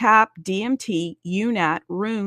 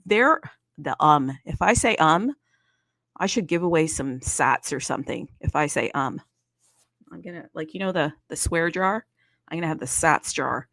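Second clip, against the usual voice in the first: normal talking voice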